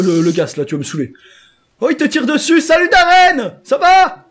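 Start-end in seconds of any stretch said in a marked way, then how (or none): 1.8-4.3 high, loud excited hail; calling out. Real, not acted. Player spotting Darren under fire, high calling out. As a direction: holler a greeting across the distance. Calls out to friend in game.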